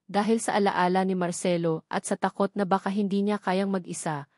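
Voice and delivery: ordinary delivery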